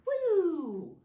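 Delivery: high, loud playful whoop; excited whoop. Pretend. Teacher throwing a pretend snowball for the kids, gleeful whoop.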